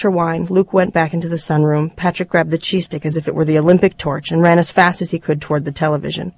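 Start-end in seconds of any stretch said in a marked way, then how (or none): none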